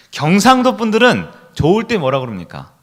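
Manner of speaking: ordinary talk, nothing marked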